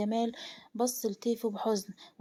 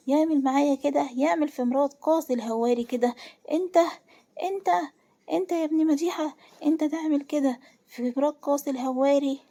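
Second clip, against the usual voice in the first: raised, louder outraged narration of a man lashing out; beside himself with rage; 4 semitones higher, 4 dB louder than usual. A narrator's reading. Qasi, beating the man who wronged his wife, enraged. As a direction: act out an indignant, high-pitched confrontation. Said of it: "قاسي مش حاسس بنفسه".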